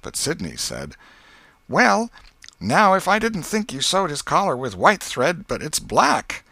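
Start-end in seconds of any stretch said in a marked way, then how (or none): 1.7-6.4 put-on higher, louder prissy boy voice; smug little brother telling on Tom; high, loud tattletale brother; Sid tattling. A narrator's reading.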